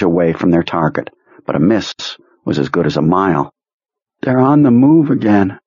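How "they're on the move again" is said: weak, washed out. A narrator's reading. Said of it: "He sounded weak and washed out".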